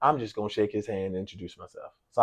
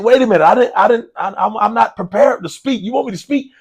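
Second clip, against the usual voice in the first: much higher and louder, stammering in alarm; flustered panic at being called up front; panicked disbelief; 11 semitones higher, 11 dB louder than usual. Retold or imitated. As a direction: blurt it out like you've been caught off guard. Retells own panicked reply.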